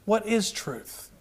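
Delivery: hushed and drawn out; quiet slow question. Shown as a demonstration. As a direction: let it sink in, soft and slow. Repeats question for emphasis.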